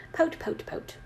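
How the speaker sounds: very soft, whispered pouting; mock pout. Co-host mimics sulking author, whispered pout. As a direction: whisper it like a pretend sulk.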